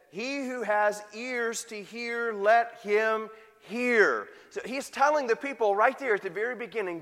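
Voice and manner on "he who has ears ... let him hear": slow, drawn-out solemn scripture line; slow solemn quote. Retold or imitated. Preacher quoting Christ to the congregation, slow and solemn. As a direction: let him hear, stretched out slowly. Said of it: "and he said".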